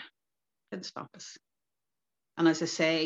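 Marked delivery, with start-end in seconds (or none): 0.0-1.4 whispered, slow and emphatic; hushed defiance. Real, not acted. Telling the interviewer they kept going anyway, in a whisper. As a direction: breathe it out like a shared secret. Defiant aside about the ban.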